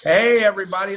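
loud, bright call to the audience; shouting hey to the whole crowd; loud excited greeting. Real, not acted. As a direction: boom out a hello to everybody. Host calling out to audience.

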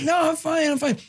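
high imitated child voice; little son. Son reassuring dad, high kid voice. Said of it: "So he's like".

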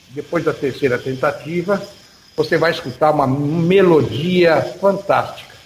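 ordinary talk, nothing marked

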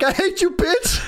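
high squealed insult; mock heckle. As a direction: squeal it mockingly. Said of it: "I'm like".

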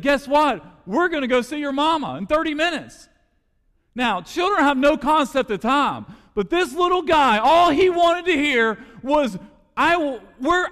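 high, loud, impassioned preaching; full-force preaching. Acted. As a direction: lift the room, preach it out to the back pew.

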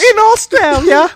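high, loud, drawn-out put-on cry; hammed-up slow wail; mock-distraught wail. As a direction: stretch it out high and loud, playing along. Joins the sad mother bit.

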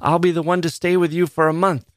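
high, excited outburst; impassioned high-pitched declaration; bursting cry. A narrator's reading. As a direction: let it burst out, pitch well up. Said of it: "a cry was already bursting".

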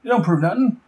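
high panicked Boomerang protesting to the gangster boss. Retold or imitated. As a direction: yelp out the denials in panic. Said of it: "Boomerang's like".

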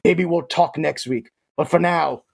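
normal talking voice

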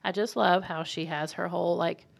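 ordinary talk, nothing marked

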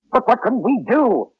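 high, helpless cry; desperate outburst. Acted. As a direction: burst out in desperation, pitch jumping up. Despairing outburst to Murray.

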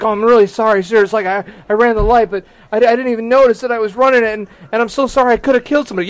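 high, hurried mock-flustered apology; panicked apologizing. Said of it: "I'm apologizing to him".

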